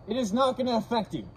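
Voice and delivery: ordinary talk, nothing marked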